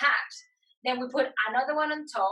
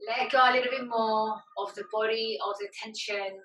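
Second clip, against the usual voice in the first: measured relaxation-class voice, leaving space to breathe; guided meditation. Acted. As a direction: guide them inward, phrase by phrase, unhurried. Leads yoga meditation.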